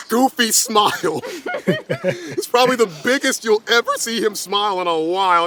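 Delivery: usual speaking voice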